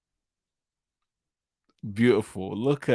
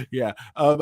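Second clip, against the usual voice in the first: ordinary talk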